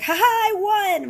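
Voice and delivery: high, loud triumphant crow; mock victory. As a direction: crow over the win like a kid. Tickling daughter, mock victory.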